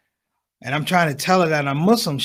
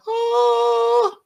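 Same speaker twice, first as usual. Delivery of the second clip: high, sustained angelic "oh"; mock celestial tone from a glorious beard; beautiful heavenly sound. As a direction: hold one pure high note. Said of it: "beautiful sounds like".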